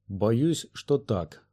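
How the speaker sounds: ordinary delivery, nothing marked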